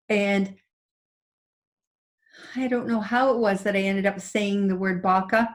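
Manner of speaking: normal talking voice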